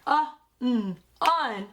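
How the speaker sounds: "Uh, mm, un" as separate sounds, then blended; sounding out. Shown as a demonstration. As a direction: sound out "Uh, mm" slowly, then join as "un". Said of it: "sound out and break down".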